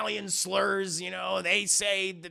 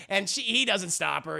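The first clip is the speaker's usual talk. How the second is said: ordinary delivery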